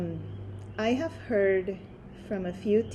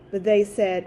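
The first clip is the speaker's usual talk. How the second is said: ordinary delivery, nothing marked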